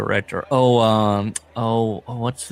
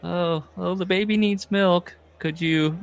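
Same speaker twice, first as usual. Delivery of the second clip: high-pitched put-on spouse voice; mock needy spouse, pitched way up; pleading spouse; 9 semitones higher than usual. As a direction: plead in a much higher voice. Voices a spouse begging for money.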